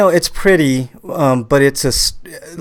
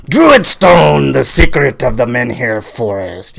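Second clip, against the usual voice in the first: Celtic warrior; slower than usual. Shown as a demonstration. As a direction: announce it like a Celtic war chief. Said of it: "put some gusto behind that".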